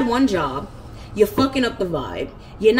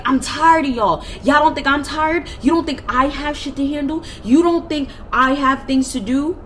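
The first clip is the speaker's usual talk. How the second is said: angry rant; 6 semitones higher, 3 dB louder than usual. Retold or imitated. Ranting at her family in front of the movers, high and loud. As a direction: vent it at all of them.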